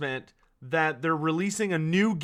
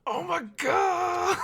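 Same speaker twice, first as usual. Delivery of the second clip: drawn-out, high excited scream; real freak-out; 12 semitones higher, slower than usual. Real, not acted. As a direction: scream it out in overwhelmed excitement, dragging the last word. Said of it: "I'm freaking out".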